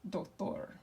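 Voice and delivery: doctor in Spanish, voice dropped, weight on "tor"; stress on last syllable. Shown as a demonstration. Teacher repeats for class, softly, doctor stressed on the final "tor". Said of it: "the way we pronounce this is".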